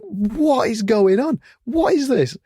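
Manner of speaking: high, slow, baffled; stunned, drawn-out high questions; disbelief. Retold or imitated. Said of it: "And, and I'm like".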